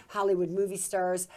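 usual speaking voice

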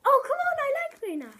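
excited outburst. Real, not acted. Reacts to guessing wrong.

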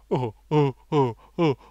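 lightly hammed-up spluttering fop; hint of a bumbling leading man; mock Hugh Grant stammer. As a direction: stammer out the question like a flustered Englishman. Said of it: "Hugh Grant says".

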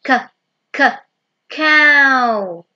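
k, k, cow with the k sound tapped out twice, then cow said loudly; teacher sounding out cow for kids to copy. Shown as a demonstration. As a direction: break the word cow into k, k, cow for children. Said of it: "Bây giờ cô [name] hướng dẫn".

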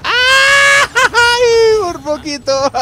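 very high shriek breaking into a cry; high shout of a close call; shrieking near miss. Real, not acted. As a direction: scream it in relief.

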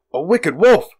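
high, loud shout of alarm; alarmed cry. A narrator's reading. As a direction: cry out at the danger. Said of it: "cried Weasel".

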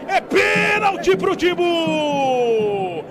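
stretched, high urgent shout; excited penalty call. Real, not acted. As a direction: hold out the last word.

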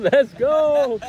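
high, loud cheering yell; fan shout. Real, not acted. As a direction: shout it out like a stadium crowd. Cheering for the Chiefs.

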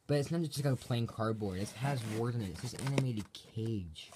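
ordinary delivery

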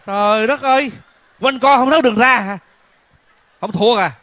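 high, loud mock outrage at the singer; exasperated shock. Host reacts to the old man's silly song with loud outrage. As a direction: throw up your hands, shrieking in disbelief.

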